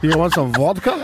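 teasing Russian impression; playful fake Russian accent; mock Russian accent. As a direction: imitate a Russian offering a drink. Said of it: "That was Russian".

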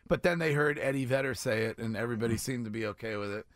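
ordinary talk, nothing marked

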